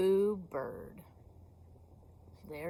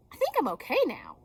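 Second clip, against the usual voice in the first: quick cheerful; 6 semitones higher, 6 dB louder, faster than usual. Acted. Bird recovers.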